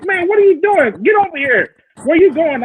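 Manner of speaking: high, exclaiming mock summons; retold calling out. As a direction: imitate a brother calling you over to the car. Retold brother calling him over.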